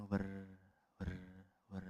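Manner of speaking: soft whirring wind noise; air conditioner hum imitation. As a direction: whir it like an AC fan at night. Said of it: "Suara anginnya A C kedengaran".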